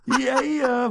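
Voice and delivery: high, head-back yeah yeah; Mordecai's yeah yeah. Retold or imitated. Imitating Mordecai's head-tossed catchphrase, high. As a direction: throw the head back and sing it. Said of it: "he throws his head back and he's like".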